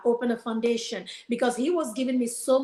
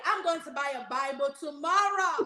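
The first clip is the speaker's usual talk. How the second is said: excited shout; 6 semitones higher than usual. Retold or imitated. Joyful announcement after relief.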